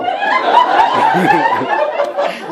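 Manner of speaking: crowd laughter. Real, not acted. Audience laughing at joke.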